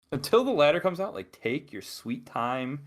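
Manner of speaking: ordinary talk, nothing marked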